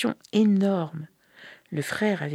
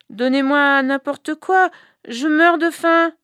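high, loud starving beggar's plea; plaintive beggar voice; 10 semitones higher, 5 dB louder than usual. Acted. Zalgoum begging her brother's wife for food, doleful. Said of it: "Elle fit sa voix dolente".

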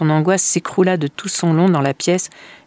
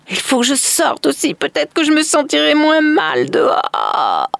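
high, strained cry; desperate woman; 11 semitones higher, 4 dB louder than usual. Acted. Woman resolves to flee outside, desperate. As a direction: wail it out, last word stretched long.

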